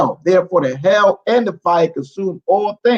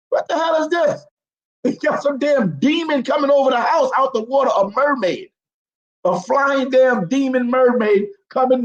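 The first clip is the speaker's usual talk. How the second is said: incredulous outrage; 7 semitones higher than usual. Real, not acted. Reading the bible, outraged at the story. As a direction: yell in disbelief at how crazy this is.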